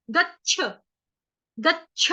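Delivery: गच्छ with a sharp, jerked छ; crisp chh. Shown as a demonstration. Teacher shows a sharp छ sound in गच्छ. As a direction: snap out the छ in गच्छ. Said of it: "झटके के साथ छ बोलना है".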